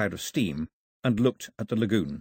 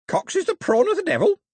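very high, loud parrot screech; piercingly high bird voice; squawking parrot; 5 dB louder than usual. A narrator's reading. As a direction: shriek it out like a parrot. Parrot shrieks over the beach.

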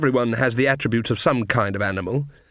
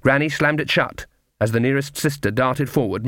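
plain reading voice

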